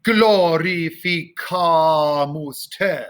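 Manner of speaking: "Glorificamus te" slow, strong GL at the start; sustained choral chant with emphatic GL onset; big G L. Shown as a demonstration. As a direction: hit the GL hard, then stretch the vowels. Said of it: "Big G L".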